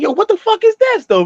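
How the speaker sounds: high-pitched, incredulous outburst; retold astonishment. Friends walking under a police helicopter, retold in high disbelief. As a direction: act out being baffled, voice jumping up high.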